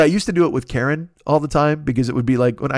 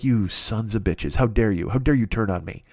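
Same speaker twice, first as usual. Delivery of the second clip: much lower, softer, slow wounded lament; mock wounded betrayal; 7 semitones lower, 4 dB quieter than usual. Accusing his chat of betrayal, soft mock lament. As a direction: grieve the betrayal like a tragic hero.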